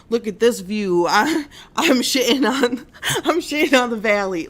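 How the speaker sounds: mock bragging through laughter; laughing. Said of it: "Sorry, it's so funny".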